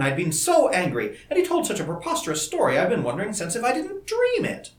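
eager questions tumbling out, pitched high; agitated young woman. A narrator's reading. Voices Dorothy, startled by the news.

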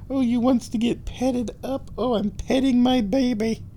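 high-pitched, doting kitty voice; pet talk. Real, not acted. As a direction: coo at a cat wanting attention. Baby-talk while petting cat.

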